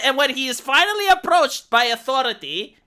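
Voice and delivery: usual speaking voice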